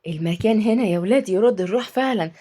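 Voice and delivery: ordinary delivery, nothing marked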